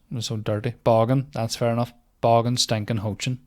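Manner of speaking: ordinary talk, nothing marked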